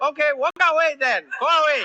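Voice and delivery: high, loud dismissive dispatcher; South African operator. Acted. Operator dismisses a scared caller, high South African operator voice. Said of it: "if you had South African operators".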